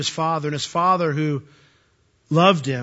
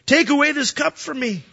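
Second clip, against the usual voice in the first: crying out to his father; 7 semitones higher than usual. Retold or imitated. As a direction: beg the father to take the cup away. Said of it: "He's crying out to his father".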